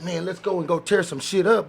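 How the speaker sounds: ordinary delivery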